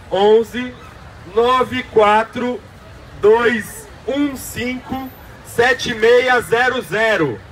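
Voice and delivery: digits spaced out one by one, slow; slow, with pauses. Shown as a demonstration. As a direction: dictate each number slowly. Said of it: "vou falar pausadamente".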